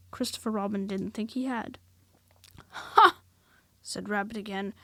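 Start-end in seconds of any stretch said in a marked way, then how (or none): 2.7-3.2 high, loud knowing Ha!; Rabbit's triumphant ha. A narrator's reading. Rabbit to Christopher Robin, loud high knowing Ha!. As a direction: bark out a knowing Ha!.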